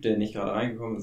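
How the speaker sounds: ordinary talk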